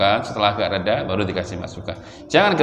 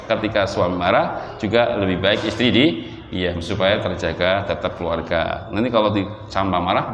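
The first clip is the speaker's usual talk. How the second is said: normal talking voice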